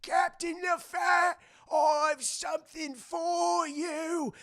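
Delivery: put-on high, very slow old rat cry; hammed-up doddery wail; ancient cabin boy. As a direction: call feebly after the captain. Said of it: "cabin boy rat's like".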